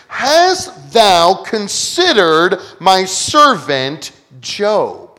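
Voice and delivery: "Hast thou considered my servant Job" drawn out very slowly, each word weighed; slow, weighty question. Shown as a demonstration. Preacher lingering over God's question to Satan, very slow and weighty. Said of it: "What a question to be asked".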